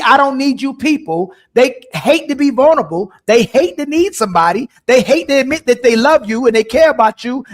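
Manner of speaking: loud, high, fired-up rant; heated rant. Real, not acted. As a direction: build to a passionate outcry. Heated rant about women.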